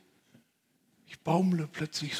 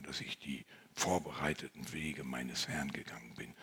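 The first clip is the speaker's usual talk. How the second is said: hushed; 10 semitones lower, 13 dB quieter than usual. Acted. Hushed confession of failure.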